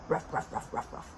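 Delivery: soft, breath-only little ruffs in a quick string; dog bark. Shown as a demonstration. Showing a child what a dog says, soft pretend barking. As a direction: woof softly without voice, like a small dog. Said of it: "What does a dog say?".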